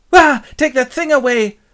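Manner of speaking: loud, high-pitched mock horror; horrified shriek. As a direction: recoil and cry out in disgust. Titania sees the beast, shrieks.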